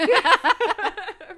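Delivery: shrieking burst of laughter; loud high laugh. Real, not acted. Big laugh.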